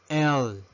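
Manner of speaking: letter L pronounced "el"; English letter L. Shown as a demonstration. Teacher modelling the English alphabet, L as "el". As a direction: say L as one-syllable "el". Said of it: "La letra L".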